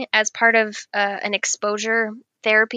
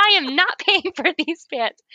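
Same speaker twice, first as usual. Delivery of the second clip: indignant refusal; 6 semitones higher than usual. Retold or imitated. Indignant refusal in fitting room.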